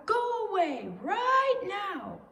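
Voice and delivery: high, loud angry shout. Acted. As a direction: yell it at the intruder. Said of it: "shouted Owl".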